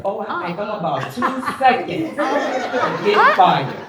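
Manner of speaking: high, laughing words. Real, not acted. Teasing her boss about being fired, laughing high. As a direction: giggle through every word.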